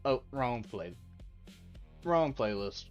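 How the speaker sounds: ordinary delivery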